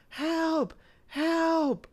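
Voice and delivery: high mock call for help from far off; imitated cry for help. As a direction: call out like a distant stranger in trouble. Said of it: "hear the people cry out for help".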